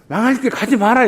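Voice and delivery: high, loud urgent plea; pleading warning. Retold or imitated. Preacher voicing an anxious parent, high and loud. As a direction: warn them off the road to ruin. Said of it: "이 말입니다".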